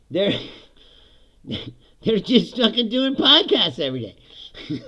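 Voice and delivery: usual speaking voice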